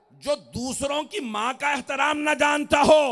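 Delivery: high, loud pronouncement swelling at the end; forceful preaching. Real, not acted. Preacher warns about respecting others' mothers, loud and high. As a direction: lift the voice into a ringing pulpit shout.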